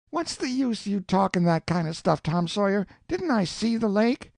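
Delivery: put-on high-pitched, protesting young voice; a boy insisting he's right; indignant boy Huck.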